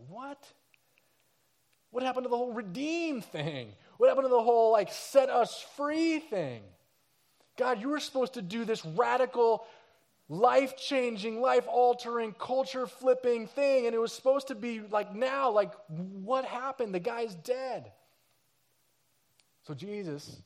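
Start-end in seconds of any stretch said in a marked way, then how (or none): 0.1-0.5 higher, stunned single question; stunned disbelief. Retold or imitated. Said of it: "People are like". 1.9-3.7 higher, puzzled and let down; bewildered question. Retold or imitated. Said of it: "People are like". 4.0-4.7 much louder, higher, demanding answers; the same question thrown out in anger; outraged repeat. Retold or imitated. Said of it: "People are like". 4.7-17.9 disillusioned outcry. Retold or imitated. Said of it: "People are like".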